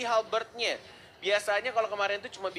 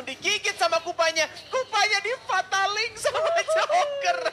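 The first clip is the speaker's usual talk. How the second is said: excited; 12 semitones higher, 6 dB louder than usual. Real, not acted. Caster hype on a pick.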